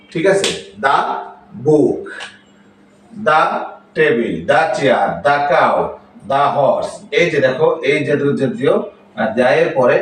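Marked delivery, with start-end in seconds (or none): 0.8-6.9 "the" pronounced da before each consonant word. Shown as a demonstration. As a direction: model THE as da, example by example. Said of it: "এর উচ্চারণ হবে দা".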